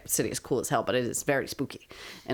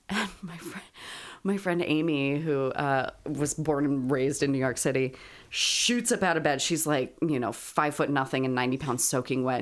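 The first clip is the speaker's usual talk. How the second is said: usual speaking voice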